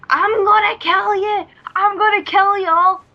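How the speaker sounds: high, loud Glaswegian yell; hammed-up Glaswegian battle cry; Glaswegian maniac. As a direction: rant it in broad Glasgow accent. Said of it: "this would be the scenario in Glasgow".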